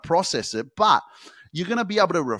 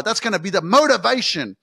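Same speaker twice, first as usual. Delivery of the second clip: usual speaking voice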